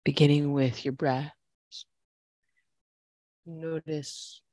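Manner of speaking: calm, steady class cues; yoga instructor voice. Acted. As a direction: guide the flow evenly.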